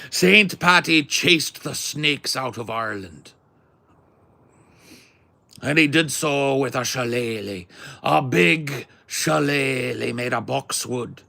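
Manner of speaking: high, loud, slow stage-Irish. Shown as a demonstration. Showing off a Saint Patty tale, high loud Irish accent. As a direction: declaim it in a hammed-up Irish brogue. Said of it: "good stereotypical Irish accent".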